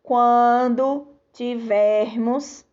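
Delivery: spoken slowly for writing down; slow dictation. Shown as a demonstration. Teacher to children, slowly dictating the rule. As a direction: dictate it slowly so kids can write. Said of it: "você vai registrar aí".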